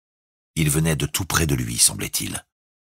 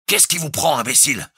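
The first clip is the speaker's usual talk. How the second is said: high, loud, harsh snap; brutal retort; 12 semitones higher, 3 dB louder than usual. A narrator's reading. Jukes snaps brutally at the frightened crewmen. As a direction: snap at them roughly. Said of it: "répondit-il brutalement".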